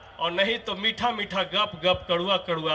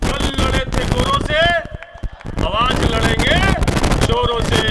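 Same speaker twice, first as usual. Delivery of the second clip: shouted slogan; 3 semitones higher, 7 dB louder than usual. Acted.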